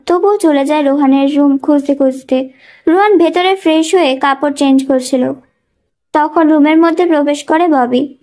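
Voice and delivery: plain reading voice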